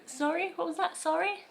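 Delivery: confused sorry. Shown as a demonstration. If I didn't hear you.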